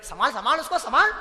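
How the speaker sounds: loud high urgent call; family calling out. Acted. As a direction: call out to the family to pick him up. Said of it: "घर के लोग".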